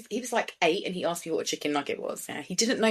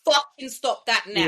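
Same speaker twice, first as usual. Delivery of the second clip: slow, louder stern order; stern parent; 3 semitones higher, 5 dB louder, slower than usual. Shown as a demonstration. Showing the stern voice for a child, stern. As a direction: bark it so they listen. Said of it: "take your voice up an octave".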